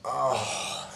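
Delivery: imitated bedtime sigh of exhaustion; long soft sigh, worn out after a long day; tired sigh. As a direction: let out a weary, airy "oh". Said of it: "because I'm so tired".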